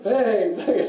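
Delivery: loud, high "bang" bursts; imitated gunshots. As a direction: fire off each bang like a shot. Imitates rounds going off mid-story.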